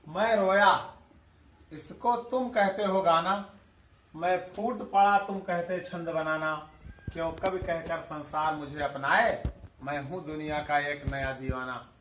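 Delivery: reading a poem aloud to students; poem recitation. Acted. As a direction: recite the lines gently, letting the last line fall away.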